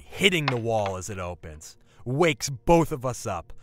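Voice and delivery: usual speaking voice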